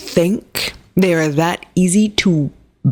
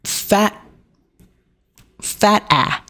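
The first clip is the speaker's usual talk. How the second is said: mock taunt; 5 semitones higher, much slower than usual. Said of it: "shouted by [name] himself over the microphone".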